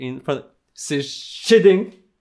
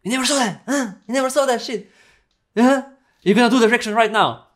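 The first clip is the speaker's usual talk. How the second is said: friend's voice; 9 semitones higher, faster than usual. Retold or imitated. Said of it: "And he was like".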